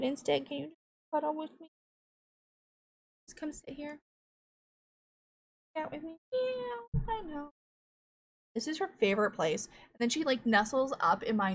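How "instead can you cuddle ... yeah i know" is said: cooing to cat. Real, not acted. Coaxing her cat to cuddle.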